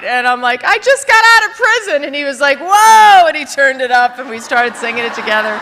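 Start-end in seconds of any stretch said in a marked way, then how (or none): 0.6-2.1 emotional outburst. Retold or imitated. Telling the cab driver while crying. 2.6-3.3 a loud, much higher amazed "Whoa!"; a cab driver blown away by the news. Retold or imitated.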